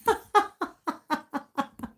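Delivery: high-pitched, pulsing run of laughter; real belly laugh. Real, not acted. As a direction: burst out in a long, helpless laugh. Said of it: "I can't stop laughing".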